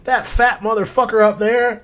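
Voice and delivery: high, loud mimicked rant; screamed insult. Son ranting about the inspector upstairs, screaming. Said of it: "screaming at his mom".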